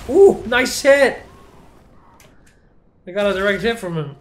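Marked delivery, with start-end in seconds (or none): 0.1-1.2 excited cheer. Real, not acted. Cheering a good hit.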